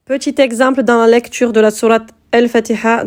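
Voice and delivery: ordinary talk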